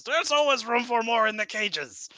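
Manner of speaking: high, loud sinister boast; menacing captor. Acted. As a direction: gloat over the captives. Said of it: "And he says".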